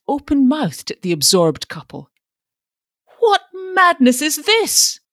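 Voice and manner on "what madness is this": high, loud, slow outraged demand; shocked, high-pitched outburst; hoarse demand. A narrator's reading. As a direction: demand it in scandalised outrage, drawing it out. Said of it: "he demanded hoarsely".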